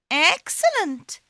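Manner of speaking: high, pleased cat voice; delighted cat. Acted. Puss triumphs.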